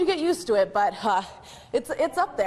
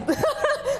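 giggle; 12 semitones higher than usual. Real, not acted. Laughing during bungee move.

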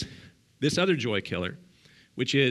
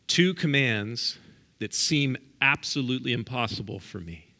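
ordinary talk, nothing marked

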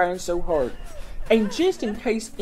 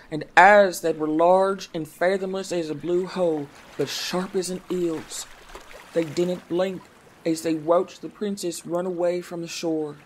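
ordinary delivery, nothing marked

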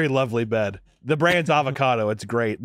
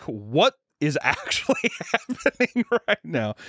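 retold disbelief; 4 semitones higher, slower than usual. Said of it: "And we were like".

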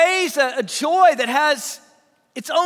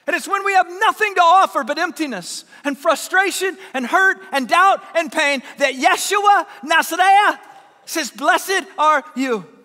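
raised, higher pulpit voice, punching key words; impassioned preaching; 4 semitones higher, 4 dB louder than usual. Real, not acted. Preacher telling desperate people they are blessed, impassioned. Preacher builds to full force.

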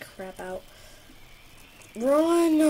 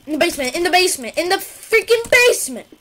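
frantic, fast, rising panic; panicked yelling; 6 semitones higher, 9 dB louder, faster than usual. Real, not acted. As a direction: scream it while fleeing. Gamer fleeing monster in basement.

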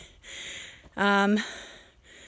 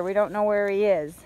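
usual speaking voice

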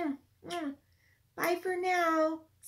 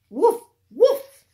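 dog barks; 3 semitones higher, 12 dB louder than usual. Acted. Teacher barking for Biscuit the puppy, loud and playful. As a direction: bark like a happy puppy.

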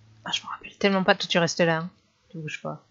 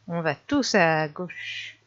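ordinary talk

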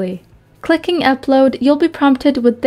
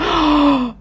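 sharp gasp; 3 semitones higher than usual. Real, not acted. Surprised during gameplay.

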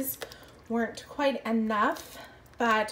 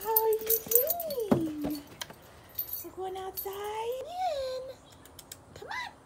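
high dog talk; 12 semitones higher, 7 dB quieter, slower than usual. Real, not acted.

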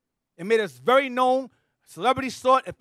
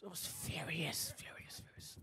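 softer and softer until whispered; fading to whisper; 6 semitones lower, 24 dB quieter, slower than usual. Real, not acted. Recalling his fury at the elder, fading to a whisper. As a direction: let the anger drain into a whisper.

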